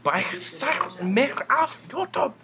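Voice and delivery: loud, high, slow mock-German outburst. Gamer breaks into fake German for YouTube, mock German accent. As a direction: put on an exaggerated German voice. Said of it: "Okay, that was in German".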